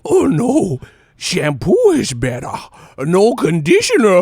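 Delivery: Billy Madison quote. Retold or imitated. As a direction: belt it like the goofy movie kid. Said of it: "Billy Madison ass shit".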